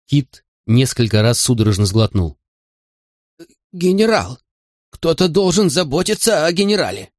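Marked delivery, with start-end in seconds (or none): 3.7-7.1 high-pitched anxious appeal; alarmed protest. A narrator's reading. Frightened old Kid worrying about the general, alarmed protest.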